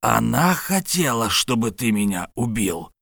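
ordinary delivery

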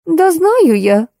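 cutting in. A narrator's reading. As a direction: interrupt with a drawn-out know-it-all. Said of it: "прервала меня Кира".

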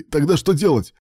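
high, loud, urgent question; alarmed question. A narrator's reading. Rescuer asking Ilya what to do, alarmed. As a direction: demand an answer in alarm.

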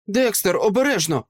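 high-pitched, urgent call of warning. A narrator's reading. Deborah warns her brother of danger behind him, calling out. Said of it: "гукнула Дебора".

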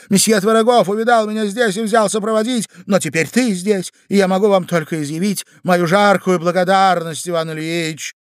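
high, loud, breakneck feminine chatter; gushing society wife. Acted. Wife invents an accident for her husband, gushing excitedly. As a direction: pour out the tale excitedly, pitched high.

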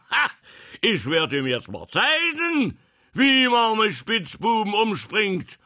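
high, very loud, threatening bellow; thunderous roar continued. A narrator's reading. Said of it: "fuhr er fort". (donnernd und drohend gebrüllt)

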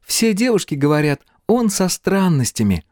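put-on high-pitched girl's voice; high girlish character voice. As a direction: voice a chatty girl, pitched well up. Girl character's gossip in story.

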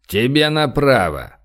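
slow, drawn-out call after someone; lightly called after a departing man, stretched out; mild call out. A narrator's reading. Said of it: "крикнули ему в спину". (негромкий протяжный окрик вслед)

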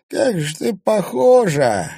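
put-on high, slow old crone voice; old crone breathing out. A narrator's reading. Old crone exhales at the girl, high and slow. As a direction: breathe the words out like a frail old woman. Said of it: "выдохнула старуха".

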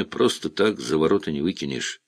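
plain reading voice

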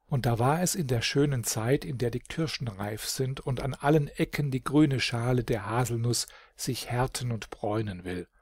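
usual speaking voice

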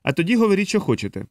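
firm, starting high and falling; mildly decisive, lifted then settling; resolute. A narrator's reading. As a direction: state it with understated resolve. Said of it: "промовив рішучим голосом Моро".

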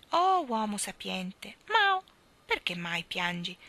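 little falling meow. Acted. Cat meows mid-speech.

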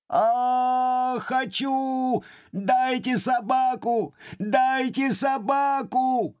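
put-on very high, slow squealing child's demand; shrieking spoiled boy. Spoiled boy shrieking at the footman for the dog. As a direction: squeal it like a bratty little boy. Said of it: "взвизгивал мальчик".

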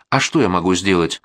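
ordinary delivery

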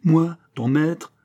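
slow, high, loud disbelief; affronted cry. A narrator's reading. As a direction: recoil at the insulting title. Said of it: "s'écria l'abbé profondément humilié de l'appellation". (exclamation d'homme humilié)

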